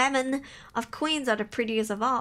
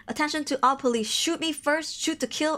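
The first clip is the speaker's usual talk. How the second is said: ordinary talk, nothing marked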